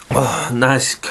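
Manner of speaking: ordinary talk, nothing marked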